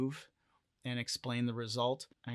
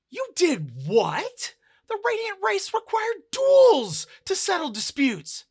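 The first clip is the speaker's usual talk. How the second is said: loud, very high disbelieving yell; shocked outcry; 12 dB louder than usual. Acted. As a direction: cry out aghast at a friend's blunder. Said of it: "Dancer cries out".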